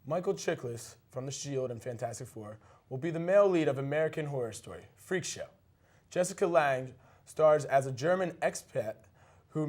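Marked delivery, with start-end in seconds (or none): none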